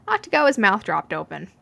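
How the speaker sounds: ordinary delivery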